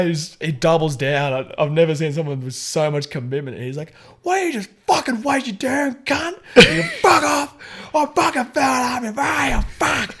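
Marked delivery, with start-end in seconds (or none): 4.2-10.1 high, strained mock shouting; angry retort. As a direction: yell back as a driver doubling down. Acted shouting match, stubborn car driver.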